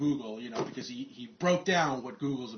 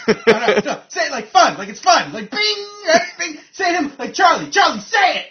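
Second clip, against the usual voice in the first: screeching, much higher and louder shouting; frantic yell; 15 dB louder than usual. Retold or imitated. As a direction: bark it at Charlie, laughing. Boss yelling at Charlie.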